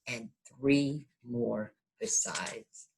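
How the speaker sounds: slow whisper to close the story; whispered ending. A narrator's reading. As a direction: hush it out like the last page at bedtime.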